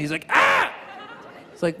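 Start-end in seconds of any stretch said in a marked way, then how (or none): 0.3-0.7 high mock baby wail; short imitated infant cry. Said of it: "he was crying".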